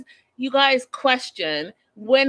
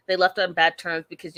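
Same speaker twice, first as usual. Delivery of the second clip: normal talking voice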